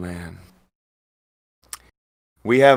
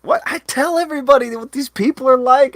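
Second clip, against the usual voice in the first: ordinary talk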